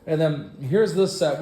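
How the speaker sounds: ordinary talk, nothing marked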